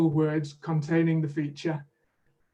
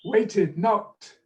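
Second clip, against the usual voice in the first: teacher leading a repetition drill; 3 dB louder than usual. Shown as a demonstration. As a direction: clear modelling for the student. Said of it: "repeat after me".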